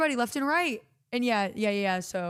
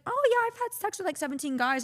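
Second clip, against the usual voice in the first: put-on much higher, carefree girl voice; breezy high school girl; 11 semitones higher than usual. A high school girl bragging to her, high carefree voice. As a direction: toss it off lightly, like it's nothing. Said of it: "they'd be like".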